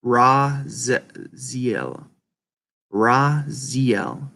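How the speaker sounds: Raziel broken slowly into rah, zee-el; practising pronunciation. Shown as a demonstration. Learning how to say a name, slow careful practice. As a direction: sound out Raziel slowly, syllable by syllable. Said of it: "Imma learn how to say it".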